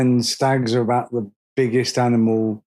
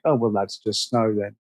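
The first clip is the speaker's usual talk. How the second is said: unimpressed Canadian; 5 semitones lower, 4 dB quieter than usual. Retold or imitated. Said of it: "in Canada, like".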